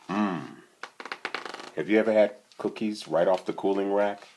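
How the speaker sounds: ordinary delivery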